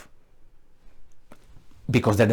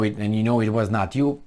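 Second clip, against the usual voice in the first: normal talking voice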